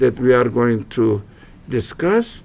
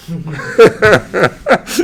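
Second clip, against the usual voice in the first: a short self-mocking laugh; amused laugh; 5 semitones higher, 3 dB louder than usual. Real, not acted. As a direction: laugh at your own joke, lightly rising. Joking about his own English.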